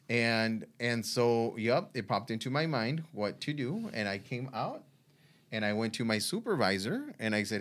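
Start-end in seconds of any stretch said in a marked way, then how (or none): none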